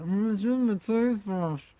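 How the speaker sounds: high, odd mock voice through a mouthful; a put-on voice answering with the toothbrush in; mumbling husband voice. Husband answers his wife through the toothbrush, mock-mumbled.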